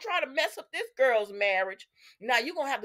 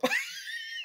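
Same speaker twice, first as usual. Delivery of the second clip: real high-pitched laugh; 9 dB quieter than usual. Real, not acted. Host laughing at the soap they hate, high real laugh. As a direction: burst into high, light laughter.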